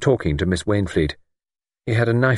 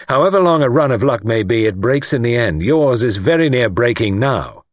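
louder, raised, forceful warning; defiant young man; 5 semitones higher, 5 dB louder than usual. A narrator's reading. Young man challenges the lord's luck, defiant. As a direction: press the warning home. Voices Luke.

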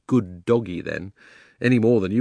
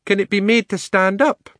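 thoughtful elephant; 11 semitones higher, 5 dB louder than usual. Acted. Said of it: "said the elephant thoughtfully".

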